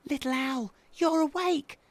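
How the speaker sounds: put-on high, thrilled baby owl; excited little owl voice; spluttered excitedly. A narrator's reading. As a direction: squeak it with delight. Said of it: "spluttered Baby Owl excitedly".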